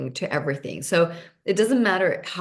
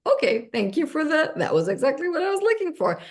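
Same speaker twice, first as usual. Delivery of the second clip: higher and quicker, delighted gratitude; thrilled thanks; 10 semitones higher than usual. Retold or imitated. Speaker thanks the universe for a find, delighted and high. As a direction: gush a quick, happy thank-you up high. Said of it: "I go on Facebook".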